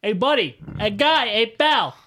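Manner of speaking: loud, high-pitched heckling; shouted retort. Acted. Player yells back at the booming enemy voice, loud and confrontational.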